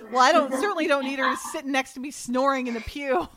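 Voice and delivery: lightly mocking, snippy retold line; snarky. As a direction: throw it off with a touch of snark. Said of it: "Dottie's so snarky".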